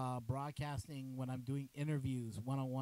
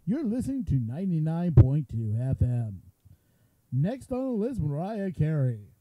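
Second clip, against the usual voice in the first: booming radio announcer; hammed-up FM radio guy; 13 dB louder than usual. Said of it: "So I sound like a radio guy".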